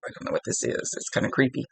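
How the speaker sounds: spooked whisper. Retold or imitated. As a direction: whisper it, a little creeped out. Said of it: "you're going".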